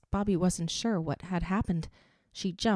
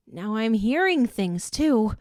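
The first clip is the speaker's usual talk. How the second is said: out loud; 4 semitones higher, 7 dB louder than usual. A narrator's reading. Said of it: "she said out loud".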